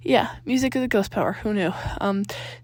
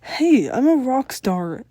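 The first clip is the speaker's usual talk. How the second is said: defensive; 3 semitones higher, 5 dB louder, much slower than usual. A narrator's reading. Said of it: "Tucker defended".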